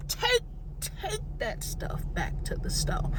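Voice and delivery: high, strained, half-crying plea; harsh outburst. Real, not acted. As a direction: tear it out of your throat, voice cracking. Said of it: "I don't mean to yell at you".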